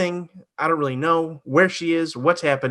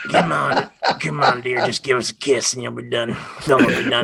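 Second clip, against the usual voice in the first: demon voice; 3 semitones lower than usual. Retold or imitated. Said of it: "the voice that this demon is doing".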